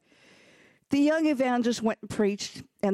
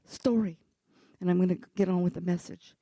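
ordinary talk, nothing marked

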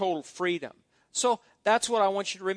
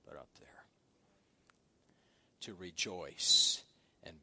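much lower and softer, almost whispered recollection; quiet reverent hunting memory; hushed storytelling; 12 semitones lower, 9 dB quieter than usual. Real, not acted. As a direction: draw them in, low and hushed.

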